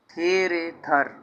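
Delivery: slow, syllable by syllable: थे, रे, थर; slow spelled-out demo. Shown as a demonstration. Teacher to students, spelling a word out slowly. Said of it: "इसी तरह फे रे फर".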